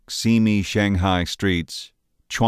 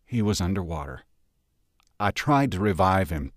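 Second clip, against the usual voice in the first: plain reading voice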